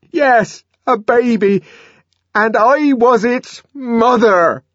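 very high, loud, defiant cry; defiant challenge. A narrator's reading. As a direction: fling it at them like a challenge. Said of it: "flung the obscenity like a challenge".

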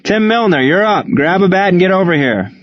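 high-pitched call across the field. Acted. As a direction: summon a player from the bench. Coach calls player from bench.

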